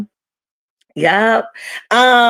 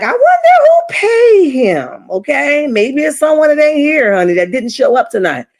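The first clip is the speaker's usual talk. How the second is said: mimicking Kelly; 6 semitones higher than usual. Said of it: "Kelly was like".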